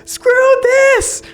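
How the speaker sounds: very high, loud, furious shriek; screaming. Acted. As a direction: keep screaming curses, hammed-up and frantic. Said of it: "He's still screaming".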